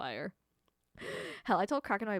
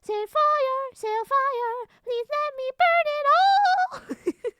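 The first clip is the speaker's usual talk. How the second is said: high, loud, drawn-out gleeful wail; hammed-up pyromaniac squeal; manic firestarter; 9 dB louder, much slower than usual. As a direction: beg to torch everything, shrieking high. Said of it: "then I went".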